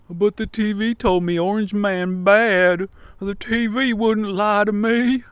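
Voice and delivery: high, mocking Southern accent. Gullible TV believer defending the news, mock Southern accent. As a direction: put on a whiny, credulous Southern accent. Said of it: "I don't know why I'm doing that accent".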